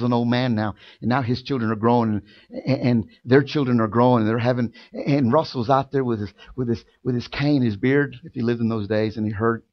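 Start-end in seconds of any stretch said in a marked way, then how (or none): none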